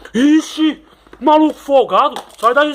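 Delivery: high, loud outraged cry; indignant yell. Acted. Toy yelling at a pushy car, outraged. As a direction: yell it at the cheeky intruder. Toy squabble over the game.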